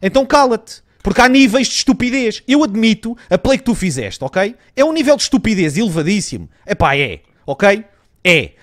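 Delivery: high, loud contemptuous scolding; angry scolding. Real, not acted. Gamer telling his teammate to shut up, loud angry scolding. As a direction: shut him down hard.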